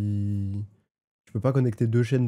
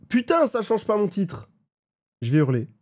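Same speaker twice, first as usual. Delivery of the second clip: frustrated outburst; 9 semitones higher, 4 dB louder than usual. Real, not acted. Frustrated at stream title bug.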